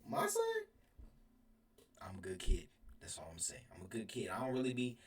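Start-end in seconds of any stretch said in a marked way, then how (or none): 0.1-0.4 shocked mom, jumping way up on son; disbelieving mother, pitch shoots up; mock outrage, lightly voiced; mom surprised. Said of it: "She was like".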